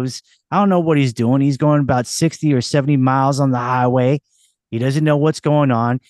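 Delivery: hammed-up Boston local; thick put-on Boston accent, street-corner chatter. Shown as a demonstration. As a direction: rattle on like a guy from Boston. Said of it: "Boston, take, take one, action".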